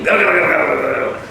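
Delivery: mock growl gar gar; growling animal noise. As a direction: growl like a running beast. Imitating animals running.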